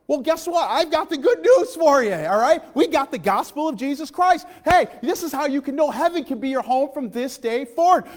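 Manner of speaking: high, loud preaching at full force. Acted. Preacher proclaiming the gospel to the congregation, loud and high. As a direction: proclaim it like good news.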